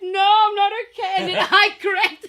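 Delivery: loud, high, laughing near tears; cracking up. Retold or imitated. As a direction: blurt it out, laughing and breaking. Retold breakdown at school gate.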